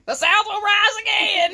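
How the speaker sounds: loud, high hammed-up battle cry; mock rallying cry. Joking with her friend, hammed-up rallying shout. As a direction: proclaim it like a mock rebel.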